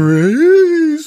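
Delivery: high, loud, drawn-out fun singsong; absurdly cheery, long-held high notes; mock chipper. Shown as a demonstration. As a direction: say a grim word in a gleefully upbeat tone. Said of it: "chipper fun tone".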